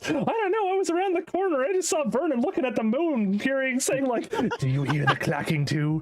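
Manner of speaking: high, laughing scared voice. Retold or imitated. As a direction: squeak it out in fright. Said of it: "scared one night".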